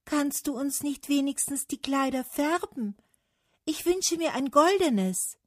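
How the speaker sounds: high, clear pleading wish; bright high voice. A narrator's reading. Birch asking Autumn for colour, high bright voice. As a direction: pipe up in a high voice. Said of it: "eine helle Stimme vernehmen ließ".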